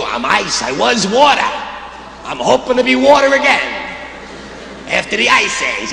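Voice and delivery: a lightly voiced ice character longing to be water; hopeful ice character. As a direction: play the ice pining to melt.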